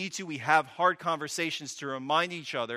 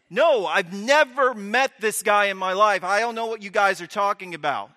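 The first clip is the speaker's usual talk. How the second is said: shouted, higher and louder; desperate shouted denial; loud panicked denial; 8 semitones higher, 12 dB louder than usual. Retold or imitated. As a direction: Peter yelling that he never met him. Peter's third denial, shouting.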